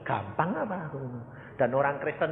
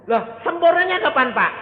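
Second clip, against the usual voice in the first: loud, high-pitched demand; mock exasperated question; 8 dB louder than usual. Questioner asks pastor when perfection comes, loud high exasperation.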